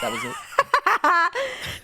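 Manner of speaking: very high, slow, laughing squeak; hammed-up squeaky impression; mock voice acting. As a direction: stretch it out in a squeaky joke voice. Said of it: "W voice acting, bro".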